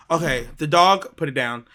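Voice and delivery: normal talking voice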